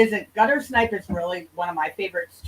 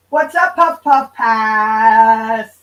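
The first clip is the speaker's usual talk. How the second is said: drawn-out greeting; 6 dB louder, much slower than usual. Real, not acted. Greeting viewer by name.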